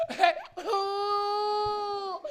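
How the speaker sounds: high mimicked baby wail; baby cry. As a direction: bawl loudly like a baby. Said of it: "I remember going".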